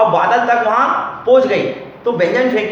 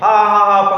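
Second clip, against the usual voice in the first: mock guffaw, louder, on a level tone; imitated loud laughing; 5 dB louder, slower than usual. Teacher acting out Franklin laughing at the priest, mock loud laugh. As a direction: fake a crazy "ha ha ha". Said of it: "वो जोर जोर से हंसने लग गए".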